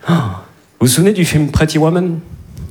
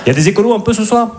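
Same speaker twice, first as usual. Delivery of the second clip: fast, higher call to the audience; hyped crowd call; 8 semitones higher, faster than usual. Real, not acted. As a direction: fire up the crowd. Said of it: "interpelle le public". (lancer au public, rapide et plus aigu)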